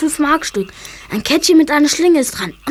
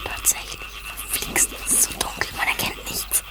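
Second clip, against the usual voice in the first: softer, anxious whisper; worried whisper; 11 dB quieter than usual. Acted. Boy hiding from the gamekeeper, worried whisper. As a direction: fret under your breath.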